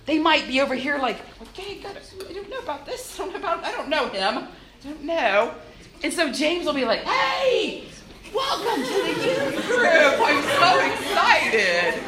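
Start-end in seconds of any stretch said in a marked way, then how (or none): 1.4-5.5 hesitant newcomer, half laughing; mock timid kid murmuring to God; nervous new kid. 7.0-12.0 much higher, bubbly laughing welcome; hammed-up excited youth, slow and beaming; mock gushing welcome to a new kid; overeager greeter. James greets newcomer, high.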